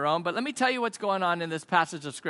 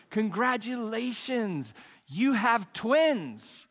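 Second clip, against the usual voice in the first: drawn-out, higher-pitched mock congratulations; congratulatory nurse; 4 semitones higher, much slower than usual. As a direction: stretch it out like a joke nurse breaking big news. Nurse announcing births in a joke.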